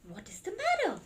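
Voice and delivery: storybook parent asking gently; concerned parent. Acted. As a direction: ask the child what's wrong. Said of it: "said Large".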